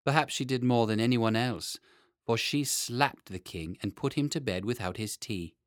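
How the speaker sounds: ordinary delivery, nothing marked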